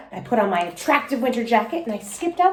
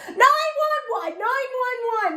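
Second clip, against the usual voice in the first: panicked cry; slower than usual. A narrator's reading. Panicked call for help.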